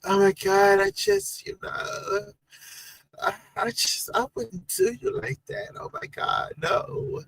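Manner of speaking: put-on high, breathy valley-girl gush; high-pitched mock valley girl voice; Denver blonde girl impression. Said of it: "[name], they would love to hear your voice again".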